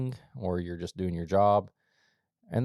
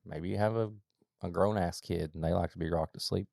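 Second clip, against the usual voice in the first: ordinary delivery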